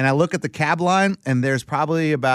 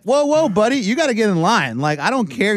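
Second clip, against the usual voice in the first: mock protest; 6 semitones higher than usual. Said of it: "I'm like".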